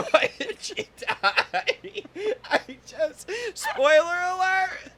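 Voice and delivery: high, slow laugh-sob breaking into a wail; laughing and crying. Real, not acted. Host grieving the film's ending, sobbing with laughter. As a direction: wail through tears and laughter. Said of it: "laughing and crying at the same time".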